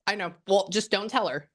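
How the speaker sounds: ordinary talk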